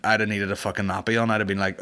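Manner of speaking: usual speaking voice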